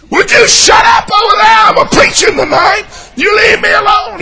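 loud, high yelling back; angry yell. Acted. Preacher yelling back at his own grudge in the dungeon. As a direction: snap shut up at the nag. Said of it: "preacher yells at grudge".

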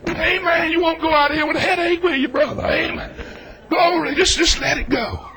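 ordinary talk

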